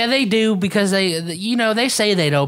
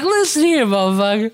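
higher, louder mock tough talk; mock confrontation; 5 semitones higher, 3 dB louder than usual. Friend imagining telling off Pete, mock angry. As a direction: square up on him, pitched high. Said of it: "I'd be like".

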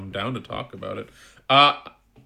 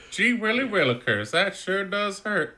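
hammy dying voice; 9 semitones higher than usual. Pretend. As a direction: ham up a cartoon death. Mock dying character line.

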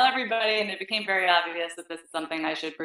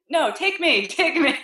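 laughing; 8 semitones higher than usual. Retold or imitated. Laughing mock plea to boss.

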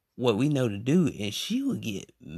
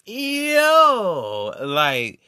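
high, loud startled yelp; shocked; 11 semitones higher, 4 dB louder, much slower than usual. Retold or imitated. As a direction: react in disbelief. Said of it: "And I was like".

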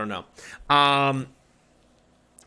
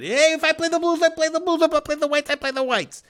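much higher voice for a laid-back buddy roll-with-it golfer, pitched way up; mimicking a carefree golf buddy. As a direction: play the easygoing buddy, high and breezy. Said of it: "He's gonna roll with it".

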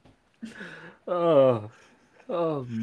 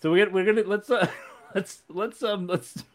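giggly stoned; 7 semitones higher than usual. Real, not acted. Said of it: "what was I even saying".

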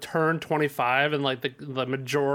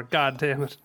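normal talking voice

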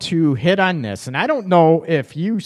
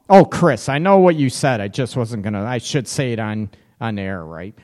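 ordinary delivery

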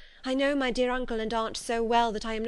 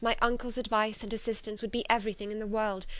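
usual speaking voice

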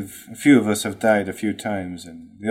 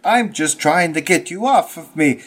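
higher, strained complaint; strained protest; 7 semitones higher than usual. Acted. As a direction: complain while pinned down. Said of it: "Giuseppe was like".

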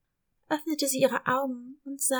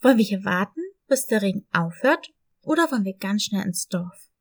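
lower, louder dog voice, asking; lower, louder friendly dog; eager puppy voice; 5 semitones lower, 8 dB louder than usual. Acted. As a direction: offer her the choice eagerly. Dog asks to wait or run.

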